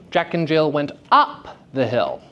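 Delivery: "up" lifted and held, standing out; stress on up. Shown as a demonstration. Teacher showing the class a shifted meaning, stress on "up". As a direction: hit the word "up" hard. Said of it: "the subtextual meaning changed".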